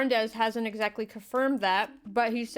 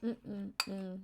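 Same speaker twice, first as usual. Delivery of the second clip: muffled, chewing; mouth full; 2 semitones lower, 12 dB quieter than usual. Real, not acted. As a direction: mumble through the food. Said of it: "I got the salty taste of cheese in my mouth".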